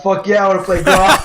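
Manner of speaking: over-the-top commercial shout with a laugh; mock advert line. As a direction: pitch it like a cheesy game trailer. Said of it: "that should have been the advert for Graw".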